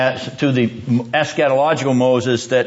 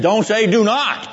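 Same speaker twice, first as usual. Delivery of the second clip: higher-pitched, insistent correction; God's emphatic rebuke; 7 semitones higher than usual. Acted. God answering Jeremiah's excuse, emphatic rebuke. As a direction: cut the excuse off with a raised pitch. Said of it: "And God says".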